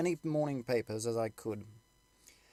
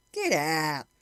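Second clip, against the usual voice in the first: scoffing soldier; 5 semitones higher, 6 dB louder than usual. Acted. Soldiers arguing.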